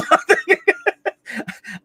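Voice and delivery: high burst of laughter; big high laugh; loud laugh. Real, not acted. Host laughing at own joke.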